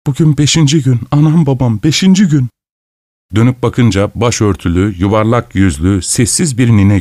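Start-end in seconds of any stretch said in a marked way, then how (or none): 0.1-2.5 put-on high, plaintive old woman's lament; tearful granny voice, pitched up. A narrator's reading. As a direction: plead as if close to tears. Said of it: "ağlamaklı bir ses duydum".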